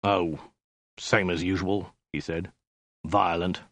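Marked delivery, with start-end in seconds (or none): 0.0-2.0 understated, tired warlord; mildly jaded sigh of a reply; weary reply. A narrator's reading. 3.0-3.6 understated, tired afterthought; jaded, matter-of-fact warlord; weary reply. A narrator's reading.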